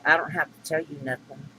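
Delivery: ordinary talk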